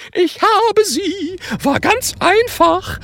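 very high, slow proud ghost voice; proud announcement. A narrator's reading. Ghost Gerd reports his theft to friends, proud and very high. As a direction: announce the victory like a smug little ghost. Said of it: "verkündet Gerd stolz".

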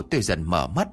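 usual speaking voice

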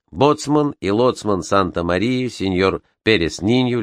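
plain reading voice

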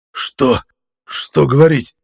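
gasping out the words in pain; panting. A narrator's reading. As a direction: pant it, writhing on the floor. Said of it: "пропыхтел я".

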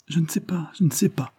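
high, sob-broken voice; young woman pleading through sobs; sobbing words. A narrator's reading. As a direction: gasp it out through tears. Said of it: "d'une voix entrecoupée par les sanglots".

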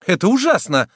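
high, loud cry of horror; horrified exclamation. A narrator's reading. Woman watching a man being choked, horrified exclamation. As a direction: exclaim it, appalled. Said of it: "воскликнула Адриенна".

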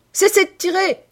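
high, very loud command; full-lunged shout. A narrator's reading. Hunter ordering the line to stop shooting, full-lunged shout. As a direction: shout it across the whole field. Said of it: "cria-t-il de toute la force de ses poumons".

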